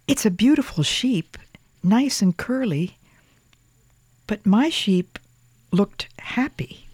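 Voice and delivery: put-on child voice. A narrator's reading. Boy voiced, whispered parts.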